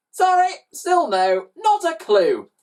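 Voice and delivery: high, loud, drawn-out brush-off; breezy denial. A narrator's reading. Villain brushes the boy off.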